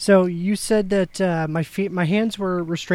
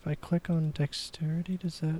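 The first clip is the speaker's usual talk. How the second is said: whispering; 9 dB quieter than usual. Real, not acted. Player asking quietly about game.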